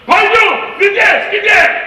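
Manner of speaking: very high, very loud barked Russian; angry cop bellowing Russian; furious Russian shouting. Retold or imitated. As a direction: scream it at him like an angry cop. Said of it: "this is what it sounds like".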